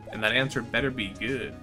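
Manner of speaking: normal talking voice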